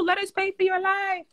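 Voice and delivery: high falsetto impression; high-pitched mocking mimicry; Melissa voice. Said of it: "the boy Melissa voice".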